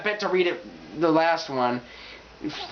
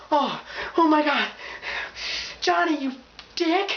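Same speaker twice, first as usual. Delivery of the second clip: high, gasping startled reader; mock startled fright; 10 semitones higher than usual. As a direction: act jolted out of a trance. Startled reader reacting to touch.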